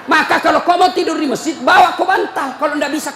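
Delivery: loud, high scolding sermon voice; fiery preaching. Acted. Preacher scolding the mosque committee, loud and fiery. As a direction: preach it hard at the committee, laughing between.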